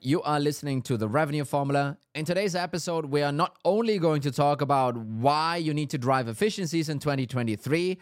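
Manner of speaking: upbeat host opening the show; bright, punchy welcome to listeners; podcast host voice; podcast intro. Acted.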